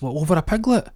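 high-pitched, acted disbelief; incredulous question. Horse trainer hears piglet may cause divorce, incredulous. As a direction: query it in disbelief, pitch leaping up.

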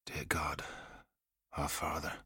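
barely audible, soft murmured prayer to God; a faint, reverent murmur; hushed prayer. A narrator's reading. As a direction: pray under your breath, alone on the summit. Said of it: "He began to pray".

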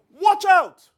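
sudden high yell of alarm; yelled warning. Pretend. Bystander yelling at a man stepping into the road, loud alarm. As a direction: shout it at someone stepping into traffic. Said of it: "you're probably going to yell".

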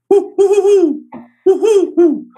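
high, loud owl hooting; male great horned owl hoots. Shown as a demonstration. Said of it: "And so the male goes".

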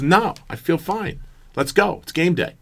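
high-pitched imitation of an eager coach; dismissive coach. Coach brushes off injury on game day, high insistent imitation.

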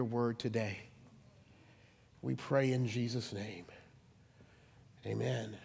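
low, hushed prayer, swelling briefly on the pleas. Real, not acted. Pastor leading the church in prayer before preaching, hushed prayer.

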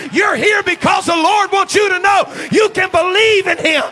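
loud, high fiery preaching, near shouting; full-force preaching. Acted. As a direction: preach it to the back row with fire.

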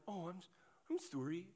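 hushed meek sorry; overly apologetic. Acted. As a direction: apologize like a polite Canadian afraid to intrude. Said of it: "kind of what I would call the Canadian option".

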